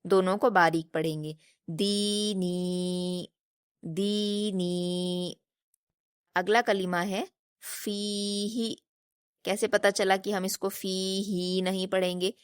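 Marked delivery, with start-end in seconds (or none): 1.7-5.3 دینی very slow, both long ی held two beats; drawn-out recitation. Shown as a demonstration. Teacher modelling the word for pupils, vowels drawn long. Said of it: "دو حرکات کھینچ کر پڑھیں گے". 7.8-8.7 in فیہ the ی is held long and the ہ said quick; drawn-out recitation. Shown as a demonstration. Teacher modelling فیہ for pupils, long ی then short ہ. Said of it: "ہا کو جلدی پڑھنا ہے اور فا کو کھینچنا ہے".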